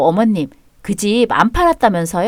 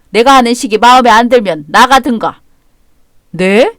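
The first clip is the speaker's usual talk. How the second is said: ordinary talk, nothing marked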